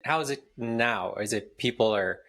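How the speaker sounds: normal talking voice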